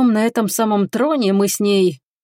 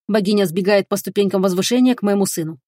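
ordinary delivery

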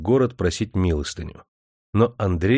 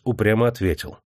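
usual speaking voice

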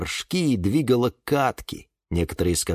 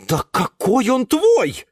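slow, higher, exasperated aunt; impatient; 11 semitones higher, 4 dB louder, slower than usual. Acted. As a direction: scoff at his claim. Glasha losing patience. (тётя Глаша теряет терпение, медленно)

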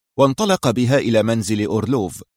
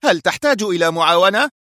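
high-pitched, eager question; keyed-up boss on the phone; boss asking; 8 semitones higher, 3 dB louder than usual. A narrator's reading. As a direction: raise the voice into an urgent offer. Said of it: "سأله المدير".

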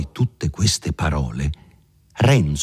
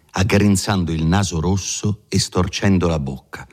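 plain reading voice

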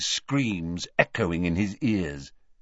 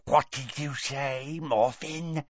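slow, softer serpent voice; Parseltongue questioning; 3 semitones higher, 5 dB quieter, slower than usual. A narrator's reading. Father questions his son, slow Parseltongue. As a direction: question him slowly, a snake-like hiss. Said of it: "said Gaunt sharply, also in Parseltongue".

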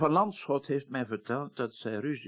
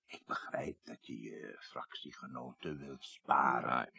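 whispered, lower and softer; confidential whisper; 10 semitones lower, 6 dB quieter than usual. Acted. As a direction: lean in and whisper it. Confidential aside to minister. (fluisterend, vertrouwelijk)

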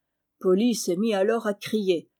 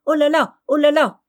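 high, louder parrot squawks; crying parrot; 6 semitones higher, 7 dB louder than usual. Acted. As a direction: screech like a scared parrot. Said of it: "en criant".

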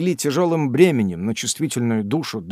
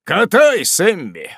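loud command; 6 semitones higher, 6 dB louder, slower than usual. A narrator's reading. Father telling Sam to start reading, loud. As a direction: call it out loud and higher. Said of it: "сказал громким голосом".

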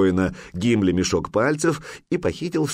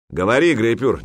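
louder, higher impatient order; displeased; 4 semitones higher, 5 dB louder than usual. A narrator's reading. The jarl pressing his silent warrior to speak, displeased. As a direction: demand an answer, displeased. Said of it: "недовольно произнёс Храгнир-хитрец".

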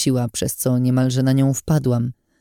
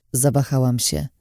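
usual speaking voice

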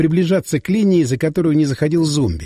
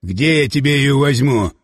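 mildly testy, put-on voice; a touch of narrated irritation; irritable retort; 3 semitones lower than usual. A narrator's reading. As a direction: snap back with restraint. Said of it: "огрызнулся замректора".

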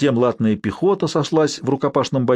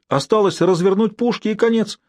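higher-pitched, lightly excited admiration; admiring; 7 semitones higher than usual. A narrator's reading. Nerl seeing the guns nearly captured, mildly excited admiration. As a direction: relish the clever move, a little excited. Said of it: "восхитился Нерль".

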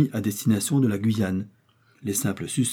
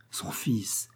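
a strangled, breathless whisper; stunned hushed gasp of the words; stifled whisper; 8 dB quieter than usual. A narrator's reading. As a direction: choke the cry back to a whisper. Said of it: "Dubief étouffa un cri".

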